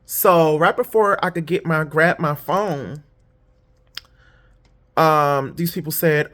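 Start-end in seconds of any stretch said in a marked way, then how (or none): none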